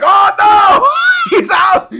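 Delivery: high, loud alarm cry; panicked goblin. Acted. Goblin seeing the ogre loose, high panicked shout. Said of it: "And the goblin's like".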